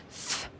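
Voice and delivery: "f" as a bare held consonant; F sound. Shown as a demonstration. Teacher showing how PH sounds, sustained soft "f". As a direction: blow a long "f" without the letter name. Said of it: "on le prononce".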